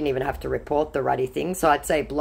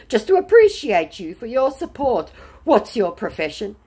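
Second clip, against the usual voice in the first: scammer's voice; 7 semitones higher, 5 dB louder than usual. Retold or imitated. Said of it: "he said".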